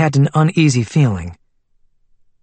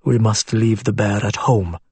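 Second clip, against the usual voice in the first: lower, softer, kind voice; gently; 5 semitones lower, 4 dB quieter than usual. A narrator's reading. Bashir tells the little girl to leave her bear, gently. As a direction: tell the child gently, like a big protector. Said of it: "gently said".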